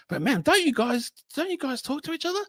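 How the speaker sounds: confused fan. Retold or imitated. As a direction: play a baffled listener asking innocently. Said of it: "when people say".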